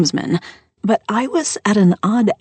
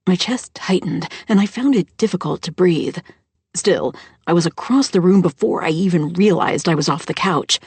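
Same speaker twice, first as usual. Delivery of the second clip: ordinary delivery, nothing marked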